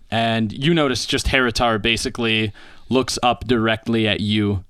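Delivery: ordinary talk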